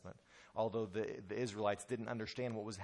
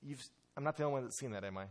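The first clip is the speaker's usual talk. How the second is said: normal talking voice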